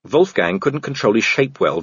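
plain reading voice

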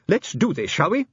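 high-pitched little official, rising on "shall we"; resolute inspector. Acted. Nervous inspector proposes the attack.

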